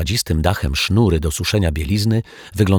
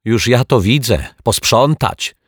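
raised, higher indignant shout; 6 semitones higher, 4 dB louder than usual. A narrator's reading. Mrs Majewska shouting at the detectives, loud. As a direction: snap it out loud and high. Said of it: "krzyknęła pani Majewska".

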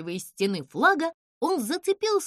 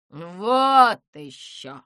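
slow, loud scoff; huffy, drawn-out owl retort; dismissive huff; 3 semitones lower, 8 dB louder, much slower than usual. A narrator's reading. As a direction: draw it out with haughty disdain. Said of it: "хмыкнула сова".

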